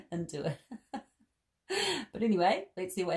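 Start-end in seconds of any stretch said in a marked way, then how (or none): 0.0-0.6 breaking into a high laugh mid-sentence. Real, not acted. Reading to kids, cracking up at the picture. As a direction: giggle through the words.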